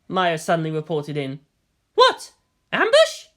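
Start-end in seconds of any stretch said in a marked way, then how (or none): 1.9-3.3 much higher, louder startled woman; alarmed commander. Acted. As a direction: snap back, alarmed. Misato reacts to the problem.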